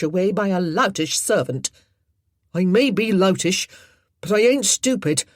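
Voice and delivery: loud, blunt working-lad anger; indignant retort. Acted. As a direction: give her a piece of your mind. Tom argues with the lady.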